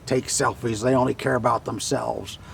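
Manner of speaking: lightly voiced old-timer complaint; a hint of a grumbling old-timer. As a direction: lightly put on an old timer griping about kids. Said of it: "all the old timers will say".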